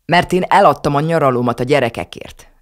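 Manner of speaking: grandma raising her voice at the table; boastful grandmother raising her voice. A narrator's reading. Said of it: "emelte fel éppen a hangját".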